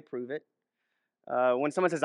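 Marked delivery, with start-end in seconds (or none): 0.0-0.4 a soft, low "Prove it." said almost under the breath; quiet prove it. Shown as a demonstration. Preacher quietly daring a boaster to prove it. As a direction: murmur "Prove it." as a calm dare. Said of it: "I might say".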